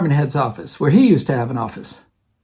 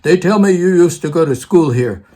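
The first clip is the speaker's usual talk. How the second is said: rough imitation of a Russian accent; 4 dB louder than usual. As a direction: imitate an old professor with an imperfect Russian accent. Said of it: "in his Russian accent, I can't do it very well".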